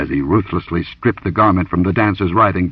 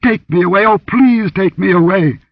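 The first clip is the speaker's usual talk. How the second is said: hysterical pleading; 12 semitones higher, 5 dB louder than usual. A narrator's reading. Dancer begs her rescuer to escape, hysterical. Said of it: "she gasped hysterically".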